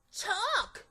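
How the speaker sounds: high and breathless with delight; a kid finding treasure in a drawer. Acted. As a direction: savor the word like a prize. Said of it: "I said".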